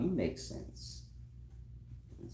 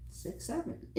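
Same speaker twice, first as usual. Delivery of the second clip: high, breathless laughing figure; 14 semitones higher, 14 dB louder than usual. Real, not acted. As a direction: gasp it out mid-laugh.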